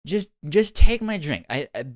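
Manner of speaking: put-on loud drunk pleading. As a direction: push the drink eagerly. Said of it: "she was like".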